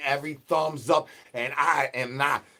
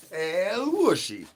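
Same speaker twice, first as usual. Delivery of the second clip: crooning call in a strange voice; high, slow put-on voice; 6 semitones higher, much slower than usual. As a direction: stretch El Wushi out slowly and high. Repeated character chant.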